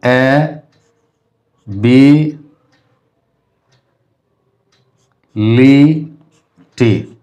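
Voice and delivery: ए, बी, ली, टी each drawn out alone; long gaps between ए... बी... ली... टी; divided syllables. Shown as a demonstration. Teacher showing the other way to divide it, divided syllables. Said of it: "और ऐसे हम कर सकते हैं".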